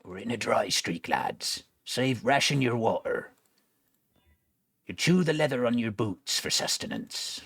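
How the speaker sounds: lightly voiced salty pirate; hint of an old sea dog. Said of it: "I keep doing the pirate voice".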